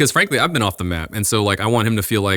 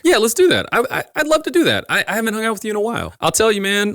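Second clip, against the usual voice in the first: much higher, gushing and stammering yes; eager friend; 12 semitones higher, faster than usual. Retold or imitated. Old friend answering a hang-out text, gushing high and fast. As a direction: bubble over with delight at being asked. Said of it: "that person's gonna be like".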